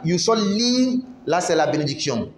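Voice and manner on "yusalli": "yusalli" drawn out, higher, with a doubled "ll"; slow doubled l. Shown as a demonstration. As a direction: stretch "yusalli" and press the "ll". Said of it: "Mais quand tu dis salla".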